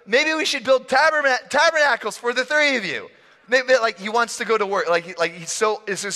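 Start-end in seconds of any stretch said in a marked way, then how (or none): 0.1-3.1 high, eager-to-please suggestion; comic overeager disciple; awkward Peter. Acted. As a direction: pitch a clumsy idea with too much enthusiasm. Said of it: "He goes".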